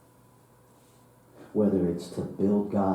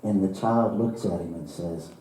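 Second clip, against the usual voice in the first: usual speaking voice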